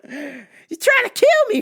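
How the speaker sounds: high and slow, mock disbelief; slow high mock outrage. Host in slow mock disbelief at attackers. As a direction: stretch it out high in mock shock. First take, slower.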